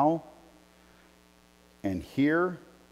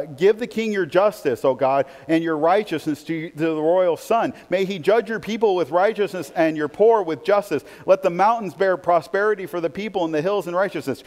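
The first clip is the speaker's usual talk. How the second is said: raised, declaiming psalm reading; scripture reading; 6 semitones higher, 5 dB louder than usual. Real, not acted. Pastor reads the psalm aloud, declaiming. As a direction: proclaim the psalm to the church.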